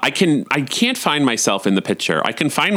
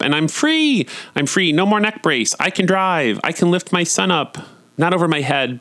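much higher, joyful freedom; excited relief; 7 semitones higher than usual. Real, not acted. Freed from her neck brace, joyful and high. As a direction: burst out with relief.

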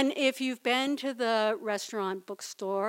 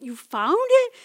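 high, loud, thrilled "found"; excited surprise; 9 semitones higher, 8 dB louder than usual. Retold or imitated. Asking her boyfriend again, high and thrilled. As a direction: squeal the question with delight. Repeated, elated question.

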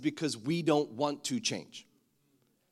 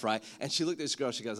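ordinary talk

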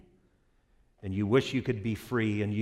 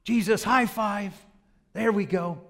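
eager fan; 8 semitones higher than usual. Acted. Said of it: "Others are going".

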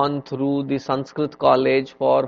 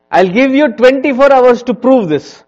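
loud, high kingly decree; royal command; 11 semitones higher, 13 dB louder than usual. Retold or imitated. Akbar giving Birbal a challenge, commanding loudly. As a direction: proclaim it like an emperor. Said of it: "he understood".

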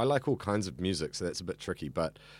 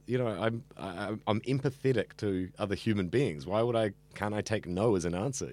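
usual speaking voice

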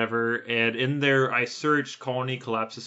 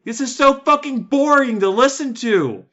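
higher, louder outraged yell; exasperated rant; 11 semitones higher, 7 dB louder than usual. Retold or imitated. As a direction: blow up at the boring podcast. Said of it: "And I'm like".

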